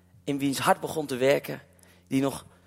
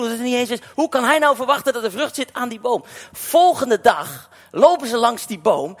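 raised, loud sermon delivery; full-force preaching; 8 semitones higher, 8 dB louder than usual. Acted. Preacher telling the fig tree story to the church, loud and raised. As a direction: drive the message home to the congregation.

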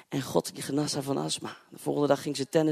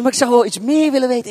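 higher, louder eager question; the curious neighbour leaning in, keen to hear more; 7 semitones higher, 12 dB louder than usual. Retold or imitated. Neighbour asking the preacher to learn more, eager and louder.

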